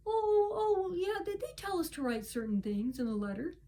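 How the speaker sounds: high, soft, caught-out voice; lightly mocking a flustered man; sheepish backpedal. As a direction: mimic him scrambling for an excuse. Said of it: "he's like".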